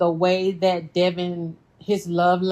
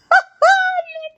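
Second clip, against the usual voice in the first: shrieking laugh, much higher; high squealing laugh; 6 dB louder than usual. Real, not acted. Laughing at Nikki.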